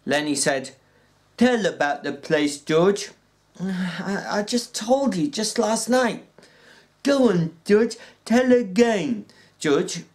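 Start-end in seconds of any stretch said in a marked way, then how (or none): 1.4-10.0 Lenny's voice, high and pleading. Acted. Lenny begging George to tell again, high and pleading. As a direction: beg like a big simple child.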